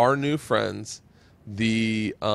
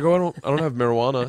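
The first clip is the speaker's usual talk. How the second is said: quick, flustered denial; fast flustered reply; 2 semitones higher, much faster than usual. Retold or imitated. Telling the pool party guest, fast and flustered. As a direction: rush it out, caught off guard. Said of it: "I was like".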